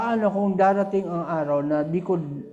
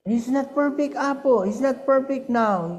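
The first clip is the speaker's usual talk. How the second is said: put-on higher childlike voice; lightly voiced child admitting a grade; a grandkid confessing, pitched up; grandchild's report; 8 semitones higher than usual. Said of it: "Sabi niya".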